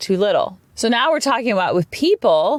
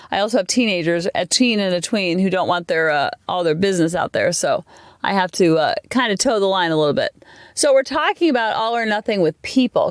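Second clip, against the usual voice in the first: ordinary delivery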